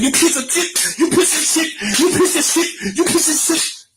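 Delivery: hammed-up shouting of an angry friend; retold angry yell. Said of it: "he kept saying".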